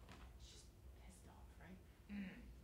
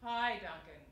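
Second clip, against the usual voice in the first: big resonant greeting, louder; big girl sound; 12 dB louder, slower than usual. Shown as a demonstration. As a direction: call it out with full sound. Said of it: "I go".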